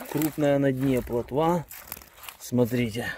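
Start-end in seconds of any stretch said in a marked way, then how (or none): none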